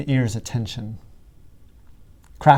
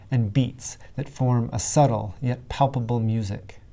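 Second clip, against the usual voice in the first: ordinary delivery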